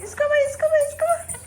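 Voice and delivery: high coaxing call to the dog. Real, not acted. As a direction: call the dog over eagerly. Calling the dog.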